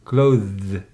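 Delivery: "Clothes" loud, one syllable, drawn-out th-z ending; pronunciation model. Shown as a demonstration. Teacher drills pupil, loud one-syllable "Clothes". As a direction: punch "Clothes" and buzz the ending. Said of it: "And now try, please".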